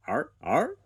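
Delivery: high, loud cawing croak; crow croak. A narrator's reading. Squawk the crow croaking at Spud, high and loud. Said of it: "croaked Squawk".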